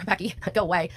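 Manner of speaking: normal talking voice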